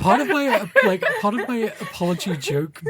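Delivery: laughing while explaining; words breaking into laughter. Real, not acted. Laughing at the apology bit.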